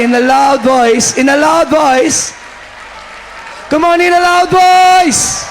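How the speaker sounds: high, drawn-out preacher's shout; loud shout. Shown as a demonstration. Preacher urging the congregation to praise God, shouting. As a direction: shout it at full force, stretching the words. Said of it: "Pwede pong sumigaw".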